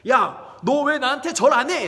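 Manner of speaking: furious, loud and high demand; angry shout. Acted. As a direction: yell it at him, fuming. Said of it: "씩씩 화를 내면서".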